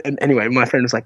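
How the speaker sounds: usual speaking voice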